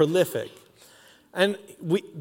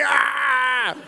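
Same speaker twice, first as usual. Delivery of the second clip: excited yell; 8 semitones higher than usual. Retold or imitated. Dancer yelling with joy at the crowd. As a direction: let out a wild whoop.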